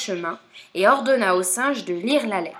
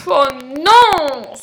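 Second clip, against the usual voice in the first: big sighed oh no, rising high; sighing dismay; 5 semitones higher, 9 dB louder than usual. Acted. Lion hears the crocodile's letter, sighing dismay. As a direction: huff out a loud, exasperated sigh. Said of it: "soupira le lion".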